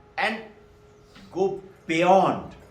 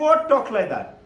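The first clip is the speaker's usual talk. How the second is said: put-on higher, louder child's comeback; indignant retort; 4 semitones higher, 6 dB louder than usual. Child answering back to mother, indignant. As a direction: throw the reproof back, pitched up.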